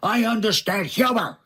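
imitated indignant outburst, starting high then dropping; hammed-up scream without much volume; Richard Kind, mad. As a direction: do an exasperated, neurotic man at his wits' end. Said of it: "he's just screaming at me".

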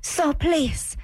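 high-pitched mimicry of a pleading mother; mocking imitation. Mimics the clip just played.

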